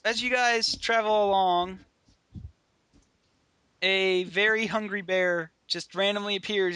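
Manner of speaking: ordinary talk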